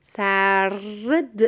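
سرد slowly, with the r held before d; clearly sounded r. Shown as a demonstration. Teacher warns pupil not to drop r, drawn-out and clear. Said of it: "ر جان اندازی گلم".

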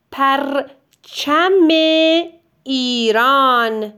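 پرچم drawn out slowly, then ایران; slow dictation. Shown as a demonstration. Teacher dictating the flag sentence slowly. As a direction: say it slowly for writing.

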